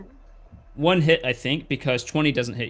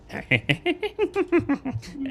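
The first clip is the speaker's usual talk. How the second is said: witch cackle; 13 semitones higher, 4 dB quieter than usual. Acted. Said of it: "hear her cackling".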